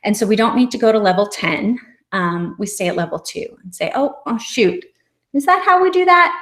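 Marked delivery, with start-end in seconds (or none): 3.9-6.3 bright, high mock surprise to a child; playful. Shown as a demonstration. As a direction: play it up as a silly oops. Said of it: "we can be playful".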